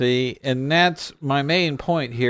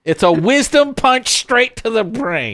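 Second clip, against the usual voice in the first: bold pitchman; 8 semitones higher, 5 dB louder than usual. Acted. Proclaiming a slogan.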